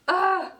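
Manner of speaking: high, loud fangirl squeal; excited scream. Real, not acted. Fan screaming at Kurata's big moment. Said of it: "let me tone down a little bit".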